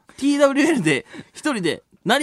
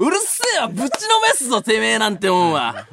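laughing mock yell, pitched up; japanese delinquent tough talk; 4 semitones higher than usual. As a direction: bark it back like a thug. Mock threat to listener.

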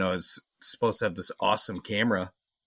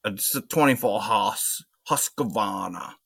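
put-on old timer Maine accent; slower than usual. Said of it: "old timer ... he's like".

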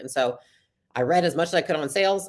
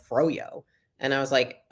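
ordinary talk, nothing marked

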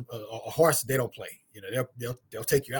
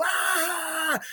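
mock crowd laughter. Crowd cracking up at him.

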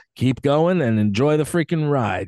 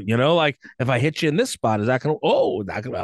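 ordinary talk

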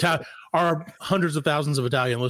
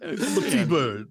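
slow, drawn-out mock greaser; John Travolta; 2 semitones higher, much slower than usual. Host plays Travolta in Grease, mock greaser voice. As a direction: stretch it out like a movie T-Bird. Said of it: "That was my John Travolta in Grease".

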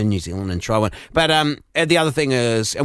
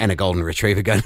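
ordinary talk

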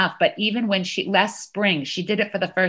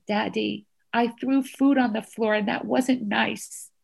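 put-on apologizing toddler; 3 semitones higher than usual. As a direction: say sorry like a small child, word by word. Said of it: "She went to him, she said".

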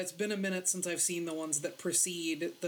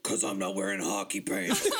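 much lower, muffled villain rumble; Bane voice; 13 semitones lower than usual. Retold or imitated. Quoting Bane's line, low muffled Bane impression. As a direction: do Bane's strange masked voice. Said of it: "such a bonkers voice".

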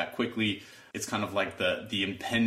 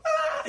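mock victim wail; 4 dB louder than usual. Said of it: "play the victim".

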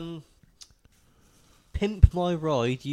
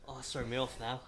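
usual speaking voice

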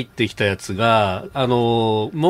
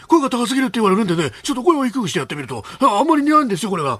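slightly lowered high politician voice; Suzuki Muneo impression; 10 semitones higher, faster than usual. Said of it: "鈴木宗男さんもっと高くねえか、声". (少し低めにした鈴木宗男のものまね)

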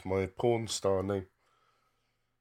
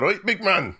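put-on Scottish accent; 11 semitones higher, 4 dB louder, slower than usual. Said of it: "Scottish people on there, they used to go".

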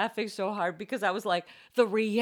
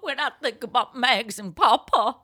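laughing imitation; 7 semitones higher, 6 dB louder than usual. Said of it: "every time he's like".

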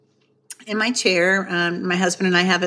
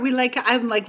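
ordinary talk

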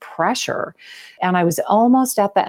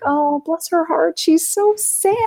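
high, slow sugary pity; mock pity; 12 semitones higher, slower than usual. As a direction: coo it with fake sympathy. Mocking onlookers pitying her tears.

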